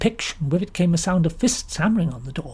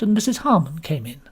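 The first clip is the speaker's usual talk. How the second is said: usual speaking voice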